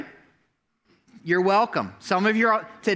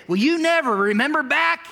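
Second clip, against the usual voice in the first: high, loud whiny jab at your partner; mock accusing spouse; 10 semitones higher, 4 dB louder than usual. Spouse in a fight, mock high accusing voice. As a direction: play the spouse dredging up old hurts, shrill and petulant.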